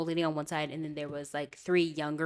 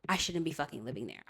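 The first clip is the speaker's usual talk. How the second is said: usual speaking voice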